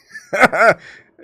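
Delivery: sudden high laugh bursting out; loud burst of laughter. Real, not acted. Amused at triggering trolls, loud laugh.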